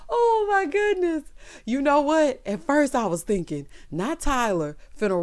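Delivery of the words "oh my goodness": much higher, slow, laugh-crying. Real, not acted. Viewer laugh-crying after the joke. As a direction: wheeze it through tears of laughter.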